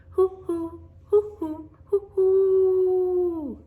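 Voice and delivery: high, loud hooting owl call; long hooting owl cry. Acted. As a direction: call out like a great horned owl. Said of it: "and then he called".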